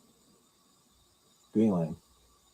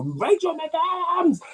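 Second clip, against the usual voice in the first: very high, drawn-out squealed scream; mock scream; much slower than usual. Imagined chat member typing a star's name, mock scream. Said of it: "scream Rachel McAdams like that".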